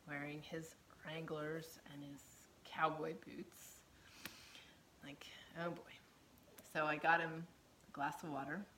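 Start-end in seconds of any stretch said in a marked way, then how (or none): 0.1-5.9 conspiratorial unvoiced whisper, slowing on cowboy boots; swooning whisper. Wife admiring his Wranglers and boots, dreamy whisper. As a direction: breathe it like a secret crush.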